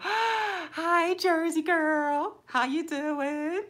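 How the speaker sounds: high, loud, slow drawn-out greeting; excited welcome. Real, not acted. Streamer spotting her Jersey girl in chat, high and excited. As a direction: gasp and gush over a favourite viewer.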